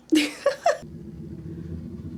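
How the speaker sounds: high, soft giggle. Real, not acted. Vlogger amused by own pun, soft giggle. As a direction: giggle quietly at your own joke.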